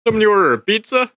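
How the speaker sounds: high, fast pizza delivery voice; chirpy cheery delivery man; delivery guy voice. Pretend. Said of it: "Fine, I'll soft".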